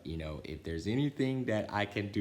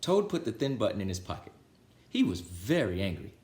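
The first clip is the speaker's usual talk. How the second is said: put-on lively, louder read-aloud voice for children; storybook reading; 4 semitones higher, 5 dB louder than usual. A narrator's reading. Reader telling Frog and Toad to children, lively storybook voice. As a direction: read it like bedtime story time for kids.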